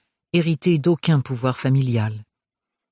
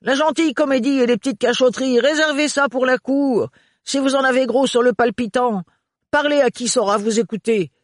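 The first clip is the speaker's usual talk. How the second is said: higher, brisk character voice; Renard's advice; 10 semitones higher than usual. Acted. Renard shouting advice to Ophélie over the train. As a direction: give blunt advice.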